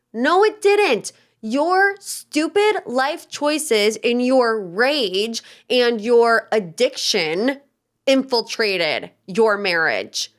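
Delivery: slow, each word stressed; slow emphatic rebuttal. Real, not acted. Host scolding Jax, slow emphatic stress. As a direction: lay out every word like a verdict.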